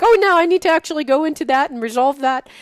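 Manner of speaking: high, loud, fast fretting; urgent retold self-talk; mock panic. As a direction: rush it out in hammed-up alarm. Acting out panicked response to ego.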